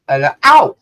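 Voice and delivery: pained ow. Real, not acted. Mic shocks her lip.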